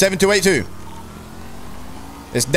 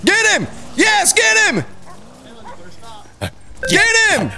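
high excited shouts urging the dog on; cheering shout; 12 semitones higher than usual. Real, not acted. Streamer cheers the police dog on, shouting high and excited. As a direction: yell it like you're cheering a dog into the chase.

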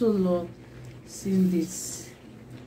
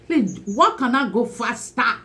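louder and higher, eager; excited; 4 semitones higher, 5 dB louder than usual. Real, not acted. Vlogger thrilled at her new laptop, eager shout. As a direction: demand it with glee.

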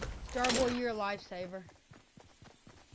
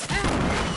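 sharp cry of pain; 7 semitones higher, 10 dB louder than usual. Real, not acted. Hit in the game, cries out.

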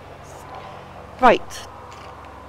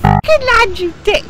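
angry swearing; 6 semitones higher, 8 dB louder than usual. Real, not acted. Angry at game.

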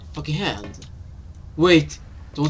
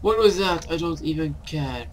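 usual speaking voice

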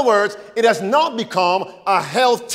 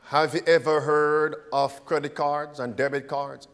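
quiet ominous; 6 semitones lower, 7 dB quieter than usual. Real, not acted. Sudden drop to low tone.

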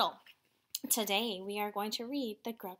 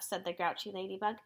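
ordinary delivery, nothing marked

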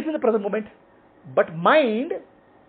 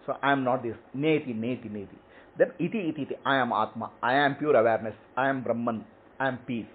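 low, soft murmured mantra; quiet affirmation; 11 semitones lower, 9 dB quieter than usual. Shown as a demonstration. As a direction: murmur each line inward like a meditation. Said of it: "You sit and close your eyes".

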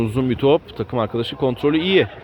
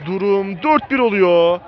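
high shouted goal call; screaming football commentator; 13 semitones higher, 3 dB louder than usual. Real, not acted. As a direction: yell the goal out. Free kick goal scored.

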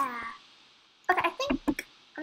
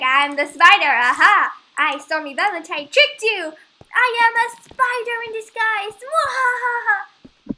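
loud gloating villain, higher pitch; 6 semitones higher, 8 dB louder than usual. Pretend. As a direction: ham it up like a cartoon villain.

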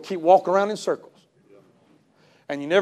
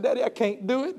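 soft, tearful plea to daddy; 3 semitones higher, 9 dB quieter than usual. Retold or imitated. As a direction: beg quietly through tears. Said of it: "I began to weep and I began to cry".

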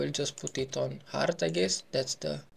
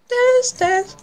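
much higher, loud playful call; squeaky sing-song; 10 dB louder than usual. Pretend. Player urging his character to dance, squeaky sing-song. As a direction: chirp it like a cheer.

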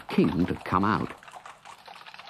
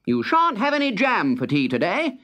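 high, mock-stern telling off; king calling out to his soldiers, much higher and scolding; 11 semitones higher than usual. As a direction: scold the soldiers like naughty children. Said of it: "Then he called out to the other soldiers".

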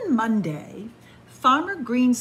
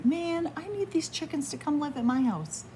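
plain reading voice

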